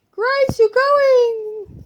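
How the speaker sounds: high, very slow sing-song; mock farewell. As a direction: draw out a playful goodbye.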